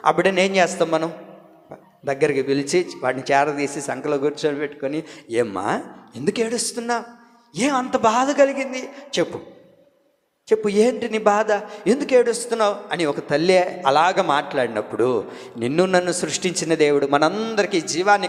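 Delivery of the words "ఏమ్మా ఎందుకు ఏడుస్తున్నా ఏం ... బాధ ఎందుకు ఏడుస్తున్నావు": a hint of a tender mother; soothing mother. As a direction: gently ask the little one what hurts. Mother comforting crying child.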